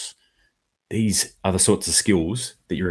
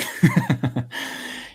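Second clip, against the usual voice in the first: short breathy laugh through the nose; a quick scoffing chuckle, no voice; airy snicker at their hypocrisy; unvoiced amused laugh; 7 semitones higher than usual. Real, not acted. Laughing at activists' retreat.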